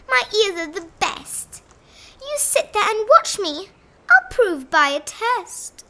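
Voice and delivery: high, loud eager rabbit; boastful rabbit. A narrator's reading. Rabbit accepting the bear's challenge, high and cocky. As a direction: declare it cockily, pitched high.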